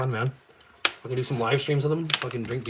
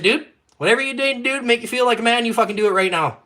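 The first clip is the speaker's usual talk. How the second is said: loud retold challenge; 11 semitones higher, 8 dB louder than usual. Challenging the friend.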